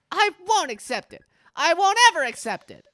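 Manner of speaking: rising furious refusal; defiant shout. Acted. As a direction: yell it back at your father. Min defies her parents.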